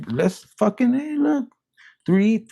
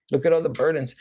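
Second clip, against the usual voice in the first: ordinary talk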